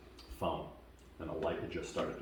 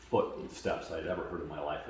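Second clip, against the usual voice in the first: ordinary talk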